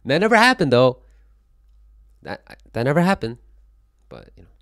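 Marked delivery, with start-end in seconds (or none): 0.0-1.0 shouted, much higher and louder; a big loud protest that it never happened; loud denial. Real, not acted. 2.2-3.4 louder and higher, insisting; stressing never, still loud but calmer; firm denial. Real, not acted. Repeats the denial.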